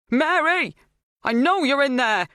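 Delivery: high, loud demand; calling through a door. A narrator's reading. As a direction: shout to the person locked inside.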